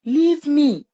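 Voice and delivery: high-pitched mock cry to be let go; retold scream. Mother retelling her son fighting off the injection, retold scream. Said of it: "then started screaming".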